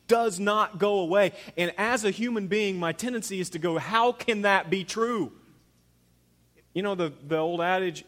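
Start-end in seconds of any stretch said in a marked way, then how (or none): 3.8-5.3 loud, high, drawn-out incredulity; acted disbelief. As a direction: exclaim it slowly in disbelief. Acted human disbelief at promise.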